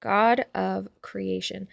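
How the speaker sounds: slow, spelled-out note taking; slow dictation. Real, not acted. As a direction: dictate it slowly for notes. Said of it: "We will be looking this up later".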